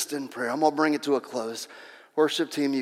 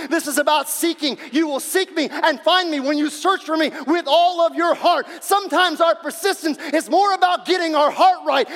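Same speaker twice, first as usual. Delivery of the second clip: raised, high-pitched preaching voice; fervent preaching; 8 semitones higher, 6 dB louder than usual. Real, not acted. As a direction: drive it home to the congregation, loud and high. Preaches at full force.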